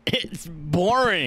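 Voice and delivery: put-on slow, drawn-out quote of boyfriend; quoting Jack. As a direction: drag it out like a bored man. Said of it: "he says".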